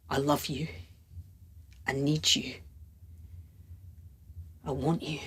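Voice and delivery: low, soft confession with long pauses; hushed love declaration. Acted. Lover declaring feelings to Roxanne, hushed and earnest. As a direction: confess it quietly and sparely.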